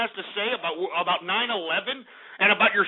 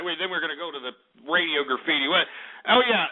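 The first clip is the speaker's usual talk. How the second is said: usual speaking voice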